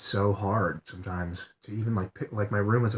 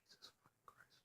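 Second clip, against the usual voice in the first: real whisper, no voice, much softer; whispered curse; 39 dB quieter than usual. Real, not acted. Alone at the end of the video, a whispered curse.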